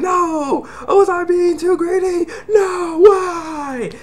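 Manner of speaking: very high, tearful mock wail; hammed-up anguished cry of regret; scared wailing. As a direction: wail it like a panicked character. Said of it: "he's getting all scared".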